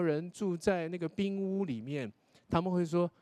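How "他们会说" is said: ordinary delivery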